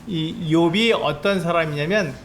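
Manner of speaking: normal talking voice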